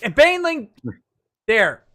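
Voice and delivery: high, loud call. Real, not acted. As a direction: call the name out. Calling out to a friend.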